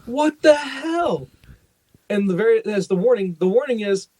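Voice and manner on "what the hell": slow, drawn-out disbelief, pitched higher; retold to a friend, drawn-out high what-the-hell; incredulous. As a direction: stretch it out like you can't believe your eyes. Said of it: "I'm like".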